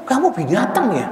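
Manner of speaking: imitating a preacher's high-pitched scolding; mock an exasperated khatib telling off children; high, worked-up rebuke without real shouting; emotional preacher. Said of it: "Sampai khatibnya emosi".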